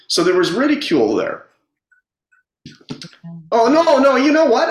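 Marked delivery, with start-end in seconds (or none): 3.5-4.7 high, louder burst of remembering; sudden recollection. Real, not acted. Speaker suddenly recalls the scene, higher and louder. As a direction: blurt it out as the memory returns. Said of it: "this just came back to my memory".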